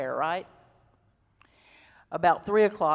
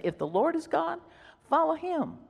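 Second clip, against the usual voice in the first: challenging call; 11 semitones higher than usual. Acted. Elijah challenges the people.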